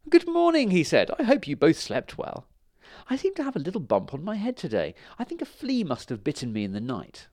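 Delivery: high, sing-out storybook good morning; breezy greeting. A narrator's reading. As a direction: start very high, then settle into casual chat. Stan breezily greets the stunned dragons.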